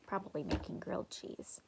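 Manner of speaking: quick whispered aside; muttered aside. A narrator's reading. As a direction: toss it off under your breath. Narrator aside.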